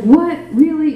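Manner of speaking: high, loud burst of shock; can't believe the news, cry it out; shocked disbelief. Retold or imitated. Told she has no herpes.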